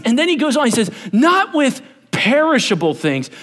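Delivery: high, driving sermon delivery; forceful preaching. Real, not acted. Preacher expounding Peter on ransom to the congregation, urgent forceful preaching. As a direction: preach the verse out with rising urgency.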